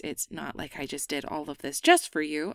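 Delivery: usual speaking voice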